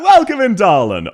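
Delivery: high, loud welcome to chat; excited greeting. Real, not acted. Greeting a new chatter, loud and high. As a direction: sing out the welcome.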